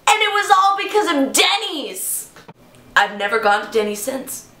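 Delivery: ordinary delivery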